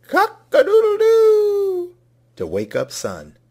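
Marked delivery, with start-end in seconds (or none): 0.0-2.0 very high, loud, drawn-out crowing; rooster crow. A narrator's reading. Rooster crowing to wake Sun, high and loud. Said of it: "Rooster crowed".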